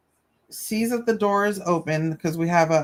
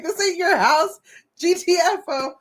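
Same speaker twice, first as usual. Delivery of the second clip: mock scolding; 12 semitones higher than usual. Scolding the cat, laughing.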